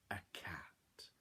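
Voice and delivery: whispered reveal. Shown as a demonstration. Teller revealing the cat to children, whispered. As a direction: let it out like a secret.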